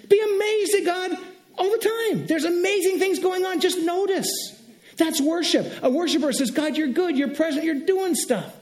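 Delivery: high, urgent wonder; excited amazement. Real, not acted. Preacher urging the church to notice God's goodness, excited. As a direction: keep the pitch up and marvel at it. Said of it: "I'm getting a little excited here".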